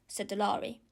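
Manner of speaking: usual speaking voice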